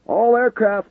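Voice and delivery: high, loud command call; shouting. A narrator's reading. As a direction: shout the order over the radio. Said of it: "the controller shouted".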